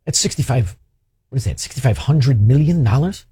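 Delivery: ordinary talk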